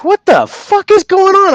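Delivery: high, loud mock freakout; retold shock. Telling a friend about the haunted movie night, high mock shock. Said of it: "I'm like".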